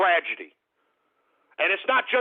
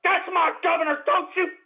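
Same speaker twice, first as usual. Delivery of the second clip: high, indignant yell; yelling at a rude commenter; outraged shout; 10 semitones higher than usual. Real, not acted. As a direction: let him have it, outraged. Outraged at joke name.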